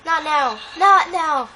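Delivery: high, loud frantic begging; panicked pleading. Real, not acted. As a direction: beg in a panic. Pleading with game, still panicking.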